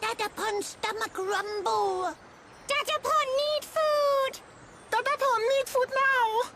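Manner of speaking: high little creature whine; tiny, insistent high voice; hungry child creature. Acted. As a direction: beg Dada for food. Hungry child begs parent for food.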